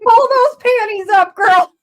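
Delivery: high, loud jokey holler; mock scolding shout. Co-host hollering advice at the heroine, playfully loud.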